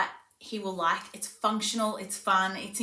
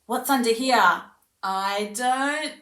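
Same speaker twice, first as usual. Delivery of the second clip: loud, eager question left hanging; sing-song prompt that stops before the last word; playful demo, trailing off; 8 dB louder, slower than usual. Shown as a demonstration. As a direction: leave the last word out for the child to fill. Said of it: "So you might say".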